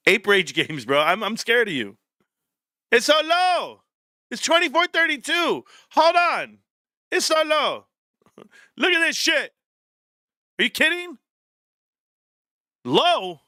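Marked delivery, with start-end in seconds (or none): 2.9-13.4 high-pitched, drawn-out incredulity; exaggerated high voice, astonished at the number; mock disbelief.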